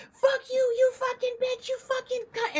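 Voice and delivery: imitating the attacker screaming through the car window; screaming woman. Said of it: "she's banging on my window".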